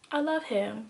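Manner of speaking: quietly repeated, unsure and small; soft helpless answer. Retold or imitated. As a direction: say it softly like a lost girl who can't explain. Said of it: "my literal answer would be".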